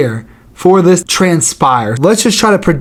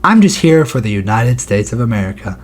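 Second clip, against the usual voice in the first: imitating Wonderboy; 6 semitones lower, 3 dB quieter than usual. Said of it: "Wonder Boy would be like".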